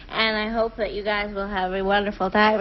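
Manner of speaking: low, slurring mock officiant; drunk judge. Judge wishes the couple well at the wedding, drunk. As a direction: imitate a tipsy judge blessing newlyweds. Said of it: "the judge was drunk".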